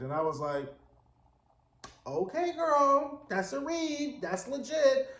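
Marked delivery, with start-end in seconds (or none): 2.1-5.1 put-on high, slow, drawn-out okay girl; impressed girl. Viewer applauding Barbara's insult, high and drawn out. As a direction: savour the read, high and slow.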